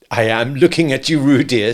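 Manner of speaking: firm motherly scolding, pitched a bit up; be stern with a naughty child; rather severe. A narrator's reading. Kanga reproving supposed Roo, rather severe. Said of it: "said Kanga rather severely".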